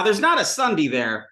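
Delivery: usual speaking voice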